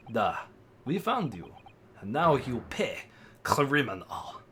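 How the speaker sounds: put-on amateur Russian accent; lightly menacing Russian-accented English. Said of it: "Oh, he's Russian".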